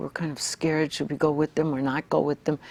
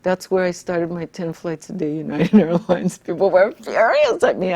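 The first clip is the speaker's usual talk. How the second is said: ordinary delivery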